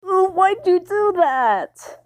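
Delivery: high, slow, drawn-out groan of complaint; disgusted whine. Acted. Luffy groaning at Zoro from the floor in disgust. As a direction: moan it from the floor. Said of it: "Luffy said in disgust".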